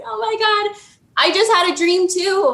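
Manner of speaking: high-pitched excited exclamation; retold amazement. Woman waking to tell boyfriend about her dream, amazed and excited.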